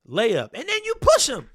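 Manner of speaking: high, loud outburst at the replay; exasperated shout. Real, not acted. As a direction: blurt it out in angry disbelief. Reacting to foul on replay.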